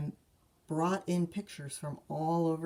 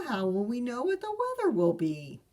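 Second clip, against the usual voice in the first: fretting papa; 6 semitones higher, 4 dB louder, faster than usual. Acted. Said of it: "continued Papa".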